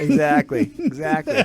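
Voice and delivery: loud then high laughing exactly; agreeing through laughter; laughing agreement. Real, not acted.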